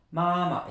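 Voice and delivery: "mama" with a high, held first syllable, the second left to drop; stress on first syllable. Shown as a demonstration. Teacher shows learners the stress, first syllable high and long. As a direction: insist on the first syllable of "mama", let the rest flow. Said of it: "On insiste bien sur la première syllabe".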